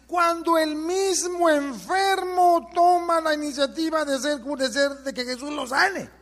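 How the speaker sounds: high, drawn-out pulpit cry; preaching at full force. Real, not acted. Preacher drives the second way home to his listeners, full-force preaching. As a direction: raise the voice high and stretch the key words.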